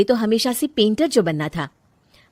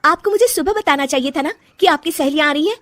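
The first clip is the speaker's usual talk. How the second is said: higher, louder and fast, snapping back; angry retort; 8 semitones higher, 5 dB louder than usual. Acted. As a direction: confront the mother-in-law. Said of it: "तू ऐसे कैसे बात कर रही है मुझसे". (गुस्से में पलटकर जवाब)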